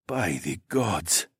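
slow, hushed and low; whispered awe. A narrator's reading. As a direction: whisper it slowly under your breath. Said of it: "Nike whispered".

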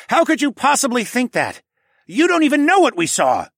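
very high, loud indignation; angry snapping. A narrator's reading. Tawa berating the polar bear, snapping angrily. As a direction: shout in outraged disbelief. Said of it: "Tawa snapped".

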